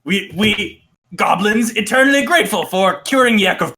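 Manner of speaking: high, pleading goblin; high goblin voice, broken speech. Acted. Game master voicing a goblin.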